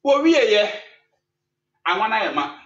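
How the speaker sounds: high, forceful announcement; preacher's loud call. Real, not acted. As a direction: call it out to the whole congregation. Calls out story climax to congregation.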